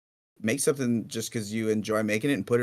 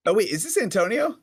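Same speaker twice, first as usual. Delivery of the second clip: sudden high recognition; oh wait, realising who it is; surprised; 9 semitones higher, 5 dB louder than usual. Real, not acted.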